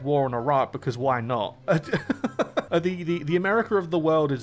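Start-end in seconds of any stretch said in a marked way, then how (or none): 1.6-2.6 a quick high amused laugh. Real, not acted. Laughing at Britain declaring war on Iraq. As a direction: burst out laughing.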